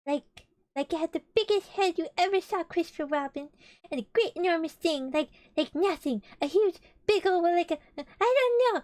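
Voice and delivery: excited Piglet, rising to a shout; frantic rambling. Acted. Piglet frantically describes the monster.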